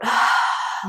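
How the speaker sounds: long, soft exhale; big sigh. Shown as a demonstration. Coach showing listeners how to let it out, big sigh. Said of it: "with a big sigh".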